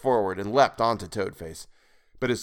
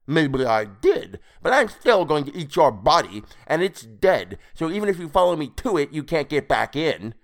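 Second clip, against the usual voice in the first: higher, louder, spiteful creature voice; defiant toad creature; 6 semitones higher, 5 dB louder than usual. A narrator's reading. Creature still vowing to eat the body, defiant. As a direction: taunt the pursuer as a toad-man.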